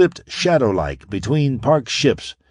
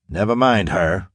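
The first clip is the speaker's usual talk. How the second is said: plain reading voice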